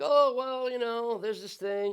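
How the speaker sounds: high, slow, shrugging-off tone; casual self-excusing mumble; dismissive excuse. Acted. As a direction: brush off your own sin lightly. Excusing your own sin.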